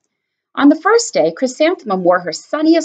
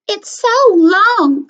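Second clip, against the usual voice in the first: high, loud, slow teasing drawl; mocking classmate; 11 semitones higher, 5 dB louder, much slower than usual. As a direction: stretch it out to tease her. Classmate mocks her name.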